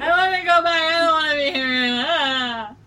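high, loud mock sobbing cry; mock traumatized wail. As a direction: wail like a haunted victim. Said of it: "They're like".

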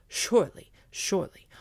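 loud, eager repeats; eager dog. Acted. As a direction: say it twice with growing eagerness.

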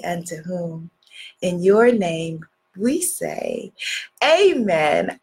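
low, hushed prayer voice. Acted. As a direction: pray quietly and evenly, low in pitch. Leads an opening prayer.

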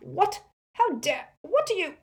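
high, loud indignant outburst; pained shriek. A narrator's reading. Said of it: "shriek with pain".

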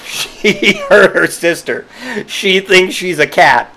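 slow, giggling disbelief; real laughter at the thought; laughing through it. Real, not acted. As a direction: say it while cracking up. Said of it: "And I'm just like".

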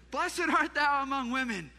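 high, loud recited blessing; blurted greeting. Acted. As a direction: cue the congregation loudly to join in. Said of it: "Elizabeth blurts out a greeting".

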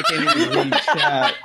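high, giggling protest; laughing. Real, not acted. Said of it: "so much spit on my screen".

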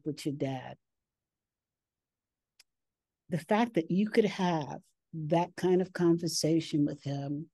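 soft, halting voice on the edge of tears; real emotion, holding back tears with long pauses; tearing up. Real, not acted. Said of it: "I'm gonna tear up".

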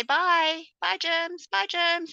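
high bright singsong farewell; singsong bye to germs. Retold or imitated. Mum to her small kids at bathtime, high singsong bye. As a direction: wave the germs off like a cheery mum. Mother voicing cheerful goodbye to germs.

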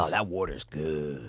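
low, soft, drawn-out murmur; slow, hushed satisfaction; savoring relief. Real, not acted. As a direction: sigh it out after a perfect sip. Savoring a drink of water.

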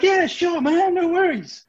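put-on high, loud, drawn-out drunken agreement; hammered Dave. Drunk crewman agreeing to watch the desk, hammered and over-keen. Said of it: "he's, like, hammered".

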